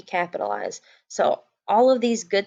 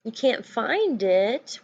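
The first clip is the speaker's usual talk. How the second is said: ordinary talk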